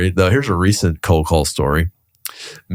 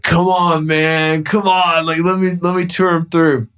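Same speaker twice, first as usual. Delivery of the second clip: high, wheedling plea; imitated pleading; 9 semitones higher, slower than usual. Colleague begging broker to let him tour clients, pleading. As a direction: beg in a raised pitch, imitating a colleague.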